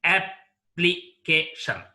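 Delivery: application in four slow, separated syllables, ap-pli-ca-tion. Shown as a demonstration. Teacher divides application into syllables, slowly and separately. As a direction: break application apart with a pause after each syllable. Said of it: "let's divide the word into syllables".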